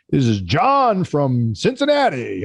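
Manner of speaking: slow, drawn-out mock radio host; radio announcer voice. Station manager on a conference call, mock radio announcer voice. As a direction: ham up a local radio announcer on a roll call. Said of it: "they sound like they're on the radio".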